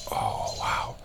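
hushed, breathless wow; whispered amazement; awed whisper. Real, not acted. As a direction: breathe it out so the owl stays put. Awed at the close owl.

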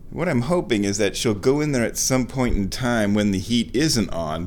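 normal talking voice

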